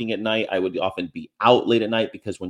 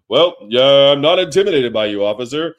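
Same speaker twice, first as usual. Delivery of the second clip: booming, higher-pitched mock defiance; loud bravado; 4 semitones higher, 8 dB louder than usual. Standing up to a cop, loud bravado. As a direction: throw it big at the officer. Acted defiant reply to an officer.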